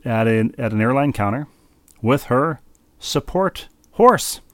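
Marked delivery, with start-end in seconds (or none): 2.0-4.4 horse drawn out slow and high; stress on horse. Acted. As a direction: land the word horse slowly. Punchline of horse story.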